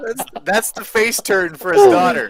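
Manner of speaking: high, loud punchline through laughter; laughing hard. Real, not acted. Co-host topping the tattoo joke, cracking up. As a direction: pitch climbing as laughter takes over.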